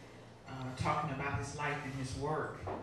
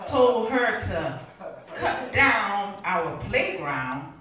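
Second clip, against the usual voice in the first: spoken-word poem building louder and higher; rhythmic poetry reading swelling into loud protest; impassioned poem recitation; 4 semitones higher, 9 dB louder, slower than usual. Acted. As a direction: recite with mounting force, punching key phrases. Poem builds to indignant demand.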